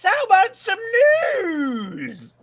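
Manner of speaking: a hammed-up high voice saying hello, chaps; put-on British voice. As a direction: play a cheery fake Brit calling out. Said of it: "You sound funny".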